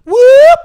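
loud high siren whoop; police siren whoop. Retold or imitated. Teller imitating the police siren behind him, loud whoop. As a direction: imitate a cop siren blip.

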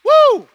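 a loud, high preacher's whoop; excited whoop. Real, not acted. Preacher whooping to fire up the church, loud and high. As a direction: let out a loud, high woo. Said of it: "I'm getting excited".

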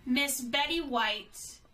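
usual speaking voice

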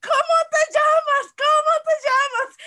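high, drawn-out shout in retelling; imitating someone screaming a question at you; retold yelling. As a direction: yell the question slowly, high and loud. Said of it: "me gritaba".

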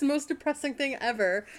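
normal talking voice

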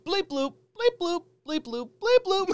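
high-pitched cartoon electronic beeps; silly high beeping through giggles; imitated bleep-bloop alerts. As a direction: squeak out repeating game notification noises. Imitates achievement pop-ups while laughing.

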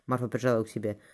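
usual speaking voice

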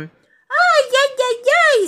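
very high, loud cartoon wail; yelping "ay ay ay". A narrator's reading. Pooh fallen off the stool, wailing complaint. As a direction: wail in comic pain. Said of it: "se quejó Pooh".

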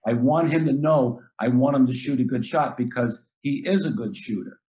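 normal talking voice

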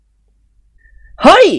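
high, loud drawn-out "เฮ้ย"; angry snap. Acted. Nikorn shouting down the noisy boy, angry. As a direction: bark at the boy to shut up. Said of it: "นิกรตวาด".

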